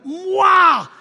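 high loud shout of sudden pain; stung and shouting; retold yelp. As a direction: yell it out like you just got stung. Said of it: "I was sitting there going".

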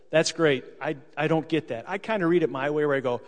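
ordinary talk, nothing marked

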